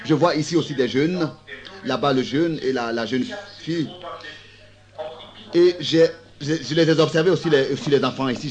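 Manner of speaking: usual speaking voice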